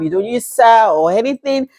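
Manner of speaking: normal talking voice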